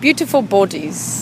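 copied English accent. Shown as a demonstration. Singer copying the interviewer's correction, put-on English accent. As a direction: draw out "Beautiful bodies" in copied English. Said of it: "it would be beautiful bodies".

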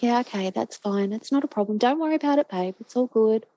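put-on easygoing reassurance; breezy reassurance. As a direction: imitate yourself brushing it off for your daughter's sake. Mum reassures daughter who must leave.

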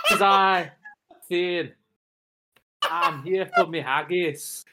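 loud, high theatrical Scottish entrance; put-on Scottish accent. Macbeth auditioner arriving again, declaimed Scottish accent. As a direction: declaim it in broad Scottish, slowing at the end. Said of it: "do you want me to do the accent".

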